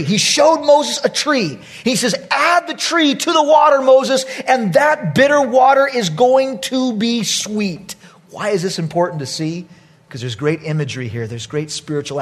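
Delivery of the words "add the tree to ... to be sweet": high, loud, declaring proclamation; emphatic command. Retold or imitated. The Lord instructing Moses at bitter water, ringing command. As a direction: proclaim it slowing into a weighty promise.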